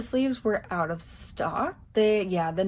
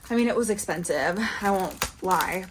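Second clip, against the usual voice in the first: normal talking voice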